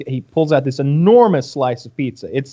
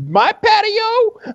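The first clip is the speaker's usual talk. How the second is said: high, slow outraged squeak; mock indignant owner; much slower than usual. Mocking the owner in the photos, high slow outrage. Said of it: "pictures of that guy being like".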